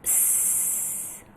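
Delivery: soft hissed s, no voice; voiceless s. Shown as a demonstration. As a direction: hiss a quiet s with no throat buzz. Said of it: "sambil mengucapkan".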